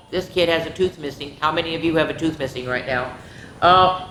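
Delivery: ordinary delivery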